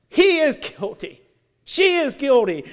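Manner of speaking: a hint of an accusing Satan, loud. Satan accusing the believer before God, loud. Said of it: "Satan points at you and says".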